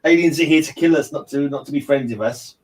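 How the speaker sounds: ordinary delivery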